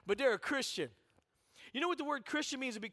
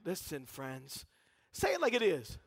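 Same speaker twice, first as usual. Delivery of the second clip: ordinary delivery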